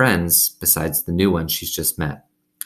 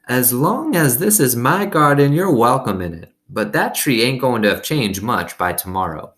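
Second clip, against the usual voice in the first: put-on high, louder old woman; kindly old woman, louder; 5 semitones higher, 3 dB louder than usual. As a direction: welcome her like a generous grandmother. Gloria Dump speaks in story.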